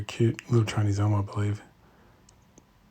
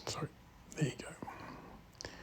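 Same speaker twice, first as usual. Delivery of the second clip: slow, breathy hushed words; drawn-out whisper while filming; 5 semitones higher, 19 dB quieter, slower than usual. Real, not acted. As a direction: barely breathe it out. Quiet remark at bonsai show.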